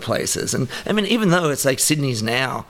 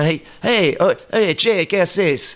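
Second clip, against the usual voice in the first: higher, drawn-out Spanish greeting; hailing a buddy; 5 semitones higher, slower than usual. Acted. Showing how Argentines greet a buddy, hailing. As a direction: call casually to get a pal's attention. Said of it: "like, buddy, you know".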